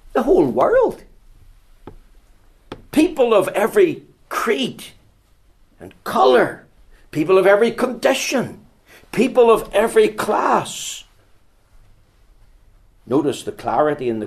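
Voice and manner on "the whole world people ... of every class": high, ringing pulpit sweep; preaching at full force. Acted. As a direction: lift it out over the whole world.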